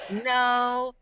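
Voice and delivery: held mock-innocent no; awkward denial. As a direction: insist on a doubtful denial. Third denial after long pause.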